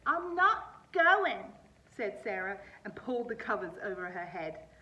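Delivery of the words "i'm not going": loud, slow, dragged-out refusal; stubborn refusal. Acted. Girl refusing her dad, slow loud whine. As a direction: stretch it out like a sulky kid.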